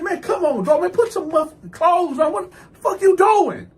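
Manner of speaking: high-pitched indignant scolding, rising to a shout; outraged. Retold or imitated. Man telling his bunkie to dress, outraged.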